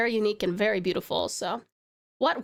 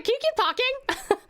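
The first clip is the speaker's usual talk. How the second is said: delighted plea; 13 semitones higher than usual. Retold or imitated. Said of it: "I was like".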